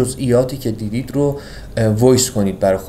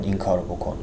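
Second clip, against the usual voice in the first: inner voice urging; 5 semitones lower, 8 dB quieter than usual. Retold or imitated. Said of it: "جوان ابدیه داره به ما میگه".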